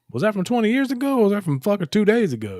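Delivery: high, loud, incredulous question; excited disbelief. Retold or imitated. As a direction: blurt it out in amazed disbelief. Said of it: "somebody's like".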